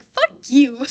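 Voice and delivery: very loud, high mock snap; jealous rival's mock retort, loud; mock angry retort. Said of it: "He's like".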